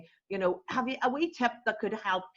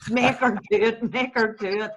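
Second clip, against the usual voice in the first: loud, insistent mock chant of viewers; viewers egging on; 3 semitones higher, 6 dB louder than usual. Host imitating viewers demanding the cluck, loud egging-on. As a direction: imitate an audience urging it on, voice up. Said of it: "the viewers are sitting there going".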